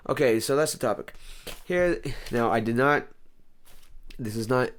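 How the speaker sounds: ordinary delivery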